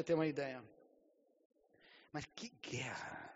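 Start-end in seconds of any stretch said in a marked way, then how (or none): 2.1-3.3 slow, whispered; awed whisper. Real, not acted. Preacher marvels at the spiritual battle, slow whisper. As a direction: breathe it out in awe. Awed aside after the miracle.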